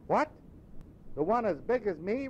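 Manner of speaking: a lad amazed anyone would ask; young boy. Acted. Boy telling Scrooge it's Christmas, puzzled. Boy's voice.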